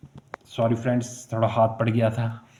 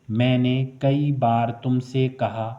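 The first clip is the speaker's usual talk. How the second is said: ordinary talk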